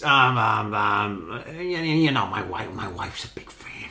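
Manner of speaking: halting mumbled impression, trailing away soft; Columbo. Detective flattering a suspect about his wife, halting impression. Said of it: "one thing he said a lot was".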